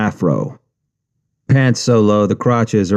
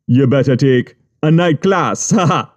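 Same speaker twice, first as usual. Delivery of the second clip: high Jamaican voice, laughing; teasing Jamaican with a laugh; laughing Jamaican boss; 5 semitones higher than usual. Retold or imitated. Boss jokes in story.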